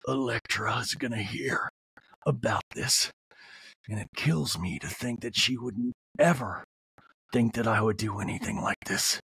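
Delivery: low, hushed, half-whispered wounded dad; mock Sean. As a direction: breathe it low and hurt, barely voiced. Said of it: "Sean's like".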